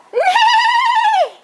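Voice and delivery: high loud burst of laughter; delighted shrieking laugh. Real, not acted. Toddler makes horse noise.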